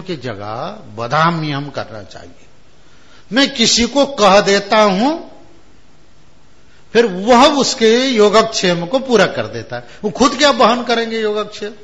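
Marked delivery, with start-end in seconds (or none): 3.3-11.7 higher, louder incredulous reasoning; doubting scholar. Retold or imitated. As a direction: argue it can't be so. (अविश्वास से तर्क करता पंडित)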